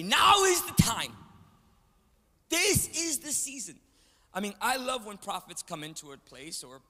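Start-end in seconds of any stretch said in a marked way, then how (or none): 0.0-3.8 shouted and slowed, a parody of a hype prophet; mock hype prophet. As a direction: bellow it slowly like a showy fake prophet. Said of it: "hearing prophets ... and say".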